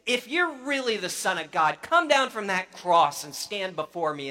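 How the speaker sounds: lightly voiced mocker daring him; mild sneer. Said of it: "people who are sneering".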